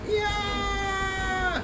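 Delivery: very high, drawn-out wail; mock crying wail. The three Straw Hats fleeing the fire attack, in a very high crying wail. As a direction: wail it like you're sobbing in terror. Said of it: "with their crying snot faces".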